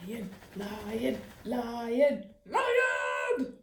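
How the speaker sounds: thought roar building to a shout. A narrator's reading. Ernie roaring in his head at the barber. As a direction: build the lion chant to a shout. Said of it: "he was thinking".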